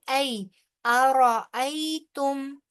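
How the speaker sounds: ऐ said short without stretching, then अरअयतुम chanted slowly; don't drag the ऐ in अरअयतुम; slow recitation with a quick ऐ; tajweed recitation. Shown as a demonstration. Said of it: "ऐ बगैर खींचे हुए तेजी से अदा करेंगे".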